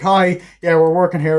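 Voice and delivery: acted casual cover. Acts out covering up to a caller.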